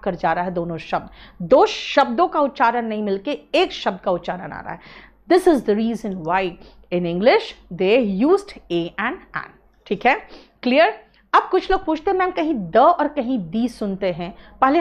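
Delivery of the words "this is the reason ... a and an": slow teaching emphasis. Shown as a demonstration. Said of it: "teacher stresses key rule".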